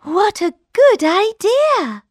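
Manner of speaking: put-on high, bright young princess; delighted princess. A narrator's reading. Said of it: "thought the princess".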